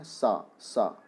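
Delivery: "सा" drawn out slowly with the tongue at the teeth; slow dental sa. Shown as a demonstration. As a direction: demonstrate "सा" slowly, a sharp dental s. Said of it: "जब हम ये सा बोलते हैं".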